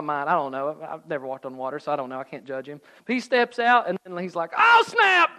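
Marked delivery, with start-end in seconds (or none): 4.6-5.3 high, loud panicked outburst; freaking out. Acted. Peter sinking on the water, freaking out. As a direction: yelp it in hammed-up panic. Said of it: "He freaks out".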